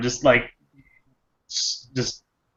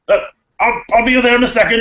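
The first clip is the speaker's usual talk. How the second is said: high, hurried call to friends; quick, raised-voice shout across a distance; 11 semitones higher, 5 dB louder, faster than usual. Acted. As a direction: call over to friends, louder and pitched up. Acted character calls to his friends.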